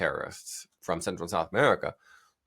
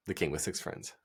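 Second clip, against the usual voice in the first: ordinary talk, nothing marked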